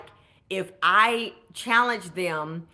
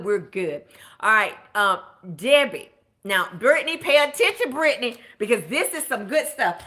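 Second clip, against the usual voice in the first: ordinary delivery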